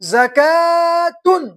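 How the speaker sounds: زکاتن drawn out slowly, za-kaa-tun; slow model reading. Shown as a demonstration. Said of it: "علا".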